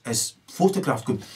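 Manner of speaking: ordinary talk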